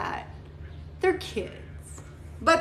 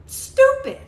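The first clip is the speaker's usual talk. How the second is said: a sudden high, loud "stupid"; fed-up rant peak, higher and louder; exasperated outburst; 13 semitones higher, 7 dB louder than usual. Real, not acted. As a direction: spit it out in disbelief. Ranting about banned words.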